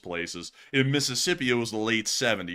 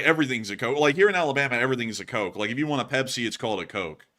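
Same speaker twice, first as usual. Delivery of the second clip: usual speaking voice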